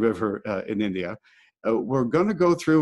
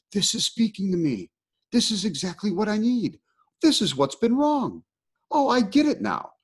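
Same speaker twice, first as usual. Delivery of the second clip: excited realization; 9 semitones higher than usual. Retold or imitated. Retelling his excitement at the book.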